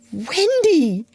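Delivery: high, gleeful character call; delighted, pitch leaping up. A narrator's reading. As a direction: burst out with glee on the name. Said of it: "he whispered gleefully".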